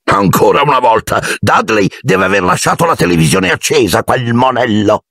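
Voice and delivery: high-pitched grumbling complaint; irritated uncle. A narrator's reading. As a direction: grumble upwards, then drag out the last words. Uncle Vernon grumbling in the hallway. (zio seccato, tono acuto)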